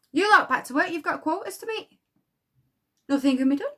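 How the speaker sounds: a light take on a cold foreman; bossy supervisor. Retold or imitated. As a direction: quote the supervisor ordering them back to work. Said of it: "You know what Julie did? Said".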